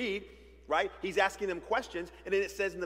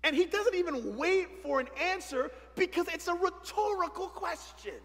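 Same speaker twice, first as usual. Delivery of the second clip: indignant; 8 semitones higher than usual. Acted. Indignant parent commentary, high voice.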